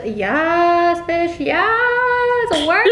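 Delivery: hype cheer. Real, not acted. Hyping friend's beauty guru pose.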